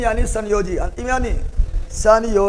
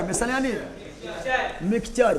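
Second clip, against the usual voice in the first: the same definition said again much softer; soft repeat; 9 dB quieter than usual. Shown as a demonstration. Teacher repeating the definition to the class, much softer. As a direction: drop the voice for the repeat. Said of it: "teacher repeats definition twice".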